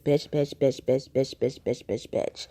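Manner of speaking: chanted insult fading to a hiss; mock angry mother. Mocking the mother's rant, mild and fading. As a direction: imitate the ranting mom, trailing off. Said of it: "been just like her mother".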